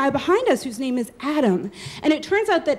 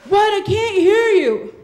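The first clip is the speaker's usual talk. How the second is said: higher, louder and slowed, straining to be heard; shouting over noise; 6 semitones higher, 5 dB louder, slower than usual. Retold or imitated. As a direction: yell across a noisy line. Stranger shouts again.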